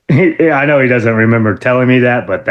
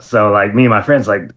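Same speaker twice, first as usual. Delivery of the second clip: normal talking voice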